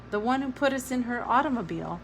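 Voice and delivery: ordinary delivery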